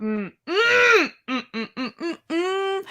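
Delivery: high crying whimpers; sob hums. Real, not acted. Fan unable to speak, whimpering sobs. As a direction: whimper through tears.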